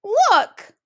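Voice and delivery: high, loud little cub shout; excited cub. Acted. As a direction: point and cry out. Little Wee Bear spots the mess.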